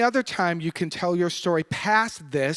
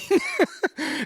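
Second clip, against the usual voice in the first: high short laugh; 13 semitones higher than usual. Real, not acted. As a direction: chuckle at the absurdity. Laughing at the memory.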